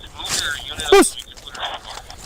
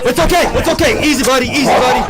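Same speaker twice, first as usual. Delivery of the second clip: urgent soothing; 8 semitones higher than usual. Real, not acted. Calming dog after the arrest.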